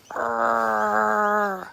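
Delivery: long, held "arrgh"; drawn-out groan of exasperation; frustrated growl. Real, not acted. Chicken owner venting at pigeons, frustrated growl.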